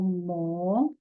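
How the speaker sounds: "mo" drawn out long; stressed syllable. Shown as a demonstration. Teacher pointing out the strongest syllable, long held "mo". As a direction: hold the vowel of "mo" slowly. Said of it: "é a sílaba mais forte".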